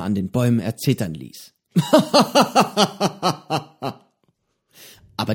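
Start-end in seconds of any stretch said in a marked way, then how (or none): 1.7-3.9 lautes, höheres Piratenlachen; dröhnend aus vollem Hals lachen; breites Lachen. A narrator's reading. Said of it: "sein breites Lachen ertönen". (breites, dröhnendes Lachen des Piraten)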